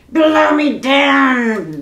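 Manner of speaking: slow, loud bellow of a pirate oath; drawn-out roar of disgust. A narrator's reading. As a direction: bellow it out like an angry captain. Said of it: "bellowed Pete".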